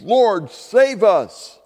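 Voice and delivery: high, loud, drawn-out plea; slow, ringing appeal; crowd calling out. Acted. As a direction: cry out slowly for rescue. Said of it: "They called out".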